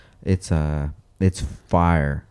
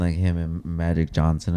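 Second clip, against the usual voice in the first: ordinary talk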